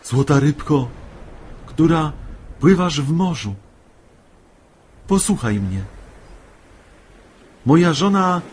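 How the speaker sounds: high, loud pleading cry; despairing call. A narrator's reading. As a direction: call out desperately to a faraway listener. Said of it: "pełen rozpaczy stanął i zawołał".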